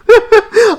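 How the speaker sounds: high, loud burst of laughter; amused laugh. Real, not acted. Reviewer amused at own stock line, amused laugh. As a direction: laugh helplessly at your own remark.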